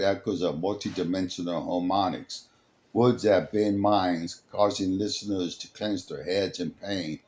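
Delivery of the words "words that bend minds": ordinary delivery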